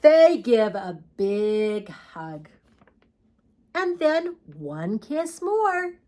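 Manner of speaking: slow, gentle storybook read; reading to children. Acted. Teacher reads the sleepy ending to children, slow gentle storytelling. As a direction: ease them toward sleep with each line. Reading picture book aloud.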